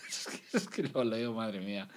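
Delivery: laughing. Real, not acted. Cracks up reading ad.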